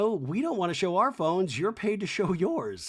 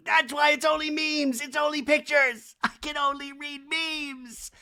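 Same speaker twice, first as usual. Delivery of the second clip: mock Kyle; 7 semitones higher than usual. Recapping Kyle's excuse to the producer in a hammed-up high voice. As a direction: ham up Kyle, shouting about memes.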